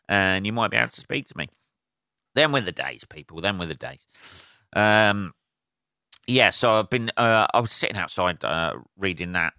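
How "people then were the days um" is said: ordinary delivery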